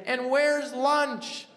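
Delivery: high, loud, slow complaint; annoyed demand. Retold or imitated. Husband loudly demanding lunch from his wife, exasperated. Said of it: "And then I say it like this".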